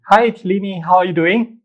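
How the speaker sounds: normal talking voice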